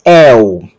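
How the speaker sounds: "el" said as éu, with open e; open-e diphthong. Shown as a demonstration. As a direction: glide "el" from open e into u. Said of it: "que vai ser pronunciado como el". ("el" como éu, com E aberto)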